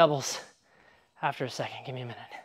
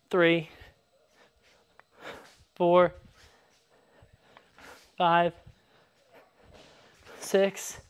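counting sword swings; 6 dB quieter, much slower than usual. Real, not acted. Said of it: "This sucks".

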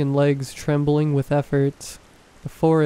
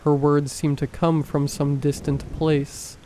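plain reading voice

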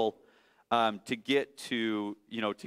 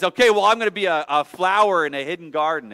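higher, louder, fast defiant little bunny; the runaway child daring his mother; 4 semitones higher, 7 dB louder, faster than usual. Acted. Bunny voice in story.

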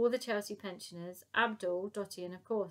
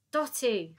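loud, impatient call; shouted; 3 semitones higher, 8 dB louder than usual. A narrator's reading. Little man losing patience with Dotty, shouting. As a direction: call the name out forcefully. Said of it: "There's no need to shout".

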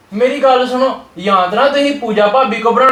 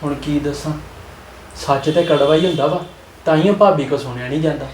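softer, lower muttered aside; quiet aside; 5 semitones lower, 6 dB quieter than usual. Acted. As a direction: confide to the audience under your breath. Aside to audience after leaving.

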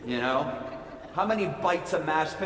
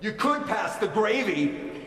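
higher, snippy demand for the gravy; rude demand; 5 semitones higher than usual. Retold or imitated. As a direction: bark it at the brother you're mad at. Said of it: "It comes out something like this".

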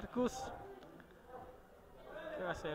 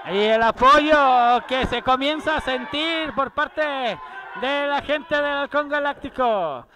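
loud hype; 4 semitones higher, 11 dB louder than usual. Real, not acted. Hyping crowd support.

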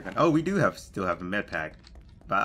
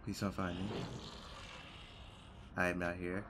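hushed plea, much softer; whispering while hiding; 2 semitones lower, 13 dB quieter than usual. Real, not acted. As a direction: hide and beg under your breath. Hiding from enemies in game.

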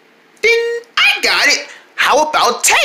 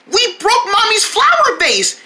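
ordinary talk, nothing marked

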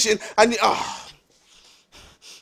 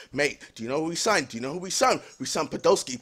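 low, much softer, restrained voice; slowing down; 9 semitones lower, 9 dB quieter than usual. Real, not acted. Fan calming himself to explain the signing, restrained. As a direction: rein it in and speak low and quietly. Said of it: "me slow down".

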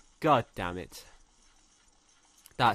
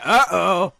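loud, high comic uh-oh; mock alarm, loud and high; imitated alarmed monster; 6 semitones higher, 10 dB louder than usual. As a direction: voice the creature realising it's caught. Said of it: "He's like".

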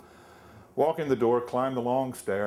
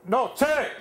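loud, much higher Pennsylvania Dutch bark; Pennsylvania Dutch shout; 5 dB louder than usual. Retold or imitated. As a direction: shout it at the new guy in a thick Pennsylvania Dutch accent. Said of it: "they talk funny and they shout".

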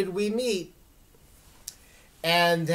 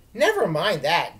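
louder, eager exclamation; exclaimed; 2 semitones higher, 7 dB louder than usual. Acted. Dylan cuts off small talk, eager exclamation. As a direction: brush off small talk with urgency. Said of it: "Dylan exclaimed".

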